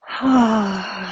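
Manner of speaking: long releasing sigh; audible sigh. Shown as a demonstration. Teacher showing the breath at the base of the spine, audible sigh. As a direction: breathe out on an open "ah".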